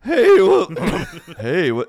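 loud leap into a squeak, then dropping; exaggerated puberty crack; mock voice cracking. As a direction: imitate a pubescent voice breaking mid-word. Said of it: "all of a sudden you're like".

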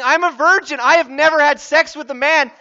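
loud, high-pitched outraged disbelief; emphatic, exasperated objection; incredulous protest. Acted. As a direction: protest hard at an impossible claim. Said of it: "She's saying".

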